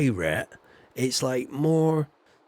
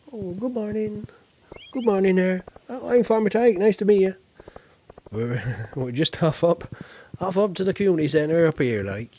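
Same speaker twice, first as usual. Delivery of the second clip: loud, higher rustic West Country farmer greeting; West Country yokel; 5 semitones higher, 3 dB louder than usual. Pretend. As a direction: hail a passer-by like a jolly West Country yokel. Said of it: "I sound like a cross between Long John Silver and Shadrach Dingle".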